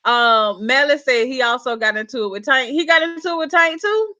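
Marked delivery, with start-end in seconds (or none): 2.7-4.2 higher, questioning surprise; surprised question. Real, not acted. Host reacting to a viewer's news about Tank, surprised question. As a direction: ask it back in real surprise, pitch climbing. Reacts to the comment just read.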